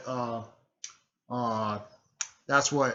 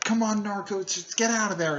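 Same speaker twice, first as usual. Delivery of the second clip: high-pitched pleading call; worried girl, pitched up; urging friend; 7 semitones higher than usual. Retold or imitated. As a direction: urge a dazed friend in a raised pitch.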